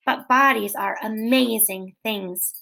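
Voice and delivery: ordinary delivery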